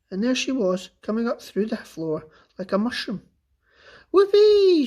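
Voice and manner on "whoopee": high, loud whoop of glee; whooping shout. A narrator's reading. Grandma whoops as she bursts through the floor. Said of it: "she shouted".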